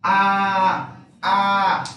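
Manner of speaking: A said open and loud; A open and full. Shown as a demonstration. Teacher modelling the open A again. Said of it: "el sonido abierto de la A".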